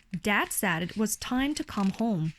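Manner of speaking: ordinary delivery, nothing marked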